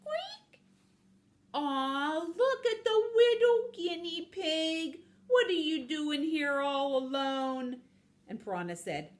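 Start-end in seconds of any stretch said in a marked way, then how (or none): 0.0-0.6 very high little squeal; tiny squeak. Acted. Imitating the squeaking noise. 1.5-7.8 high, drawn-out singsong coo; cooing baby talk. Acted. Cooing over little guinea pig.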